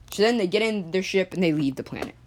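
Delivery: ordinary delivery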